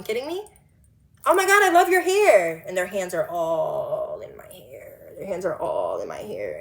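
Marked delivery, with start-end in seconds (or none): 1.2-2.6 high, loud imitated squeal of admiration; mock gushing. Imitating gushing women touching her hair. 2.7-6.6 very low, drawn-out complaint; weary dismay. Real, not acted. Dismayed at strangers touching her hair.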